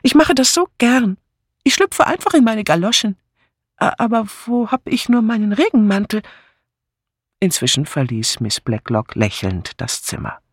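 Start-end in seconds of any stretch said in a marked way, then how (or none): 0.0-6.2 high, fussing eagerness; fluttery high-pitched voice; eager older companion. A narrator's reading. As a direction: chatter eagerly, stumbling into a fluster. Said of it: "rief Miss Banner".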